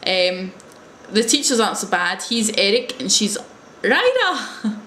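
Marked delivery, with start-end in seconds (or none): 3.8-4.8 high, drawn-out RYDER with a Japanese-style R; Japanese-style pronunciation. Retold or imitated. Giving the teacher's name as said in Japan, imitated pronunciation. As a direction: imitate a Japanese speaker saying RYDER, pitched up. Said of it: "or Lila to you and me".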